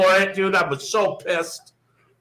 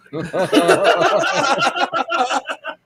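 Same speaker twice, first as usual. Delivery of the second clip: real high-pitched laughing fit; high cackling laugh; 10 semitones higher than usual. Real, not acted. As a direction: crack up in a squealing laugh. Laughing after blocked-number punchline.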